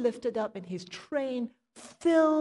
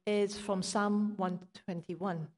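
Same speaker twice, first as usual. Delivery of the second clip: normal talking voice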